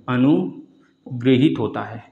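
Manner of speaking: अनुग्रहित said very slowly; teacher dictating अनुग्रहित slowly; slow model. Shown as a demonstration. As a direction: stretch each syllable of अनुग्रहित. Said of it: "क्या होता है?".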